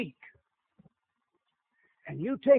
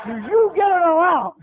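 laughing question; 5 semitones higher, 6 dB louder than usual. Real, not acted.

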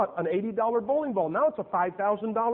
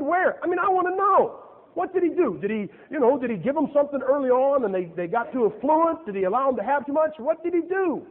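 higher and louder, eager questions; eager curiosity; 6 semitones higher, 3 dB louder than usual. Real, not acted. Father eagerly asking the caller about his boys, high and loud. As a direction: press eagerly for the secret.

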